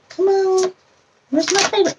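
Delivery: mock pleading while searching; playful coaxing. Real, not acted.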